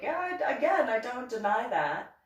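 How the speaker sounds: high, loud, insistent rebuttal; exasperated retold protest. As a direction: push back with pitch jumping up. Retold argument, protesting being called nervous.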